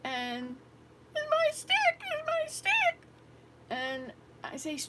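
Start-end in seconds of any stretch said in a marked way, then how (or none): 1.2-3.0 put-on high, louder wailing little girl, slow on the second; crying child. Grandmother voicing the crying girl clutching her burning stick, high wail. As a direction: whine it like a five-year-old in a tantrum. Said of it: "she's standing there crying and screaming".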